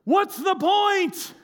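high, drawn-out yell across water; imitated yell. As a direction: holler it slowly to a distant boat, mimicking her. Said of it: "she yells to the boat".

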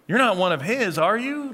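not stressed, then you rising high; questioning Peter, doubtful rise on you; leading question. Shown as a demonstration. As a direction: punch not and lift you sharply, expecting no. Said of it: "the very question lends itself to a negative answer".

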